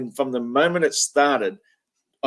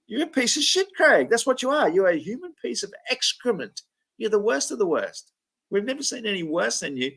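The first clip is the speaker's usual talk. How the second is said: high raised outburst of insults; mock tirade; 8 semitones higher than usual. Host voicing his own imagined rant at Craig, high and angry. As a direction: pour out the fury high-pitched. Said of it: "It's like".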